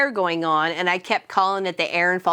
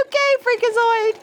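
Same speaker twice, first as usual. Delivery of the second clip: wary okay; 13 semitones higher than usual. Retold or imitated. Cashier backing away from yelling coworker, high mock wariness. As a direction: humour the crazy one from a safe distance. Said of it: "was like".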